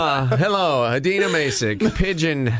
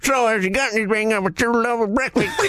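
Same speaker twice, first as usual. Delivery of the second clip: lightly higher scruffy pigeon voice; 5 semitones higher than usual. Pretend. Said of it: "this is Bielko coming for his breakfast".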